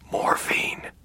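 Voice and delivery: weak, pained whisper. A narrator's reading. Father names the danger to his son, faint whisper. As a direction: breathe the word out without voice. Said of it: "he whispered".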